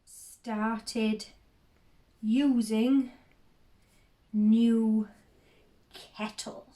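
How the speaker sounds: halting, a long gap after each word; word-by-word dictation. Acted. Noting the new kettle to herself, word-by-word dictation. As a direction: say it as if writing it down. Same word-by-word note as before.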